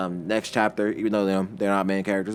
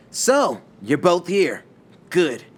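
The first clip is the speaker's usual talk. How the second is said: confident challenge; 8 semitones higher, 5 dB louder, much slower than usual. Acted. Hero confronts two guarding enemies.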